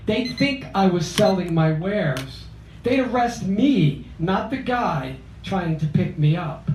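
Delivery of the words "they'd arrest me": ordinary delivery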